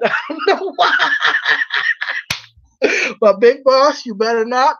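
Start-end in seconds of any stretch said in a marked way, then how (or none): 0.0-1.1 high, helpless laughter through the words; real laughing. Real, not acted. Vlogger admitting a weird connection in her head, laughing. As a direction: crack up while saying it.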